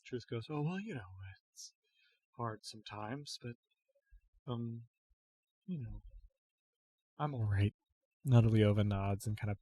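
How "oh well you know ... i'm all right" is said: much softer, hesitant and worn-out; quiet tired reply. Acted. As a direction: answer as if too drained to speak up. Weak, tired Truth answers gently.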